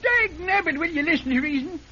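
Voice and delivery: high, fast protest; exasperated outburst. Acted. Old man accused of murder, protesting high and fast. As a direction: blurt it out, pitch shooting up.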